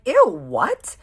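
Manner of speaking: high, loud grossed-out ew; disgusted surprise. Retold or imitated. Telling her friend she is appalled, loud disgusted squeal. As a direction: recoil in shocked disgust. Reacting to kiss story.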